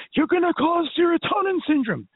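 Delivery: squeaky high mock warning. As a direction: parody a nervous critic. Mimicking critics who warn her.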